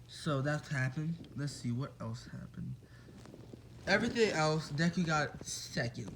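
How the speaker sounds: usual speaking voice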